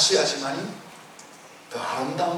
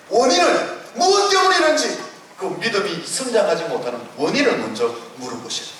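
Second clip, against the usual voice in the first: ordinary delivery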